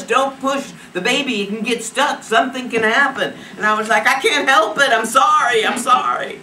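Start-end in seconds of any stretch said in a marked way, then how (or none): 0.0-3.3 higher, urgent retold warning. Medical staff to woman in labour, urgent warning. 4.1-6.4 high, loud retold pleading; frantic apology. Woman in labour to those telling her not to push, frantic apology.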